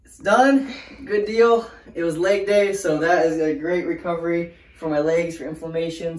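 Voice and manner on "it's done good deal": relieved. Real, not acted. Finished ice bath.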